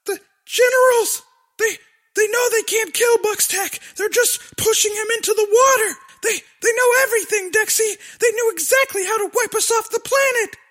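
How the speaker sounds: high, rushed and frightened; panicked girl voice. Acted. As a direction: blurt it out in a panic. Willix panicked, fast, high.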